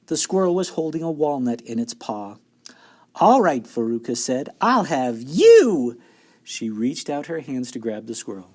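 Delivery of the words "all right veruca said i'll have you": greedy loud claim. A narrator's reading. Veruca grabs a squirrel.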